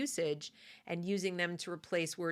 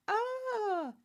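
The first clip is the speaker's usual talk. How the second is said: mock dismay. Real, not acted. Dismay at own word choice.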